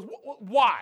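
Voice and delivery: stammering, loud panicked question; freaking out. Retold or imitated. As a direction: stutter it out in fear. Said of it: "this guy's freaking out".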